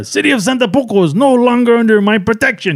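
high-pitched, hammed-up villain decree; mock movie-bandit announcement; bandit proclamation. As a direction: proclaim it to the whole town. Voicing El Guapo's proclamation.

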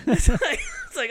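ordinary delivery

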